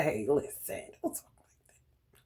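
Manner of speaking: whispered hey listen don't talk like that; mock hushed warning. Mimicking a person who shushes talk about family, whispered. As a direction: hush them like someone scolding in secret. Imitating someone hushing her.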